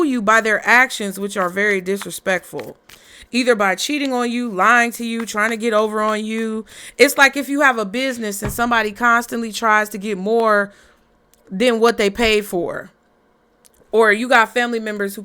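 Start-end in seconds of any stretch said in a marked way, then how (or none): none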